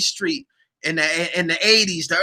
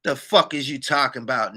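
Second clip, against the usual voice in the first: ordinary delivery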